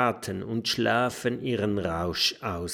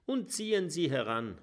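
ordinary delivery

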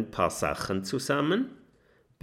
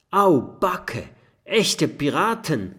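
higher, louder startled cry; alarmed surprise; 7 semitones higher, 6 dB louder than usual. A narrator's reading. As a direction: gasp it out, shocked. Sees real pirates through telescope. (hoher, lauter erschrockener Ausruf)

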